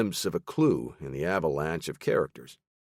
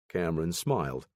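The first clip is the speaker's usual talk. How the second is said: usual speaking voice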